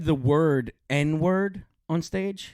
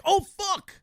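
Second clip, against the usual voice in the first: sudden realization. Retold or imitated. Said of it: "And you're like".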